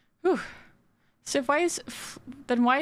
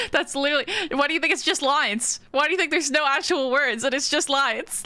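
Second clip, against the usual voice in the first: mock indignant; 6 semitones higher, 4 dB louder than usual. Mock defensive comeback to chat.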